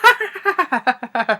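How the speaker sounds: a high, over-the-top imitation cackle; mock villain laugh. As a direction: ham up a bad guy's laugh.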